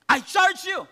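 loud, high commanding charge; a stern high priest charging Jesus. Acted. High priest voiced in sermon.

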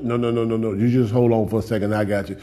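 put-on soft, low, gentle voice calming a child; tender reassuring God. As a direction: hush the worried one kindly. God calming the believer again.

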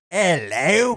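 stretched mock Cockney greeting; Cockney accent. Shown as a demonstration. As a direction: demonstrate a hammed-up Cockney accent. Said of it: "Cockney is like".

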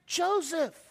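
slow, measured Bible quote; scripture reading. Real, not acted. Pastor reading Genesis to his congregation, slow and solemn. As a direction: read the verse with weight. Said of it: "The Bible says in Genesis".

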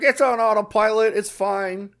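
high-pitched mock reassurance; dismissive pilot. Quoting the movie pilot drinking on the job, high mock reassurance. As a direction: sing it out high, carefree and dismissive.

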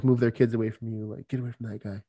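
ordinary delivery

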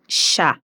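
ya said as "sha", with an sh sound; Rioplatense ya. Shown as a demonstration. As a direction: give ya the River Plate sh sound. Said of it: "Con el acento rioplatense".

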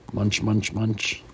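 soft chewing noises spoken as words; munch it out like eating; munching sounds. Acted. Parent reading, mimicking the inspector chewing, soft munching. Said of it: "Are you making munching sounds?".

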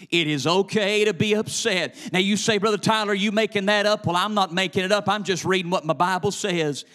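high, loud, driving sermon delivery; full-force preaching. Real, not acted. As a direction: preach it hard in rhythmic bursts.

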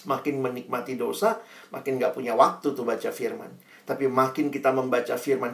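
ordinary delivery

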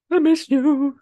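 very high, loud fake wail; badly faked tearful squeal; mock crying. Grieving customer to a lost restaurant, mock crying. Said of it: "you cry".

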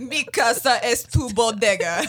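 hammed-up fake Russian accent; badly faked Russian accent; mock Russian accent. Shown as a demonstration. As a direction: deliver it as a bad Russian accent gag. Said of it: "I'm trying to make fun of their accent".

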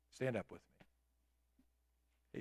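normal talking voice

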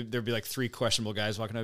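ordinary talk, nothing marked

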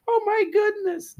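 high, loud mock gasp of Oh, my goodness; mocking exclamation. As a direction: parody the silly things people say, way up high. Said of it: "We say".

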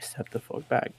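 soft, restrained retold threat; hushed warning. Student to teacher in his face, quiet warning. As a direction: say it under your voice as a warning.

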